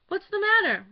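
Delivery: high, loud panicked question; frantic high call; alarmed cry. A narrator's reading. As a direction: burst in crying out in alarm. Mother bursts in alarmed.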